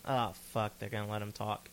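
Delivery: ordinary delivery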